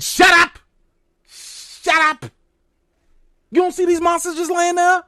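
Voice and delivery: loud, high furious shout of shut up; yelling at Tabitha to shut up, fed up; angry shout. Retold or imitated. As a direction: bark it at the screen like she can hear you.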